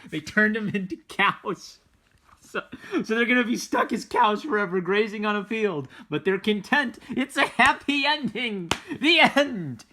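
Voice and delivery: high and loud, a hammed-up storybook finish; mock happy ending. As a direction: sell the silly ending like a delighted bedtime storyteller. Mock fairy tale happy ending.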